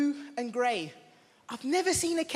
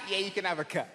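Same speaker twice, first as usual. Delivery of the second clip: clerk giving in; 7 semitones lower, faster than usual. Retold or imitated. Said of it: "she was like".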